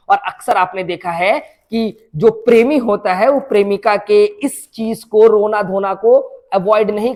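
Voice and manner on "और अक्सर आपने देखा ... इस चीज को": normal talking voice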